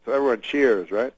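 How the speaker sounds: ordinary talk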